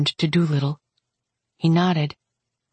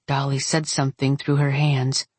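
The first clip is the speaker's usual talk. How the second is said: ordinary delivery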